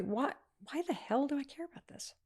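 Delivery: inner realization. Retold or imitated. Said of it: "I felt this instant peace".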